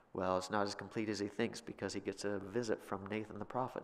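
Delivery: ordinary talk, nothing marked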